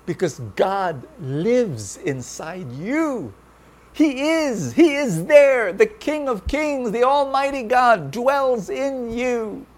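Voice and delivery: high, loud, slow proclamation; preaching at full force. Acted. Preacher proclaims God lives in the listeners. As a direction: proclaim it with fervor. Preacher exalts God's presence.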